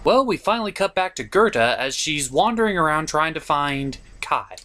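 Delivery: normal talking voice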